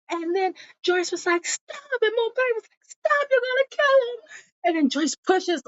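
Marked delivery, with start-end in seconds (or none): 1.5-2.0 high, soft plea; pleading stop. Retold or imitated. Joyce tries to stop the fight. 2.9-4.2 high panicked imitation; mock panic to stop the fight; alarmed plea. Mopay stops the choking.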